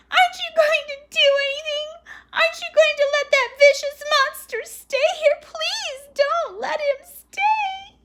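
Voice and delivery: very high, loud tearful pleading; wailing and crying. A narrator's reading. Daughter begging mother to remove the dog, wailing. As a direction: wail it, dragging out the final plea. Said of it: "Phoebe wailed and cried".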